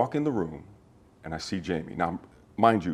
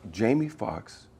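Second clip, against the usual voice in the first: normal talking voice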